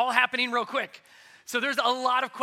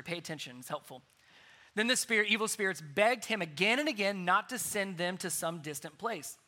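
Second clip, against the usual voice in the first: ordinary talk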